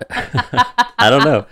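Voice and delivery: high giggling through the words; laughing. Real, not acted. Laughing at own joke.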